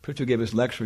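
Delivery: ordinary talk, nothing marked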